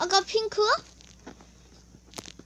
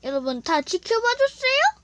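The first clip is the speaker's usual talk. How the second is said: loud, high hype call; rally the viewers; excited announcing; 6 semitones higher, 6 dB louder than usual. Real, not acted.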